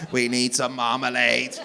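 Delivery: slow, measured robot monotone; stiff, word-by-word android delivery. Acted. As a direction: say it like a reluctant robot. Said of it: "You said you talk like a robot".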